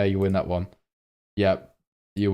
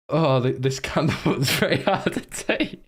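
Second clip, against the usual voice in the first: high voice broken by laughter; laughing speech; 7 semitones higher than usual. Real, not acted. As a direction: struggle to get the sentence out while laughing. Cracking up while reading joke titles.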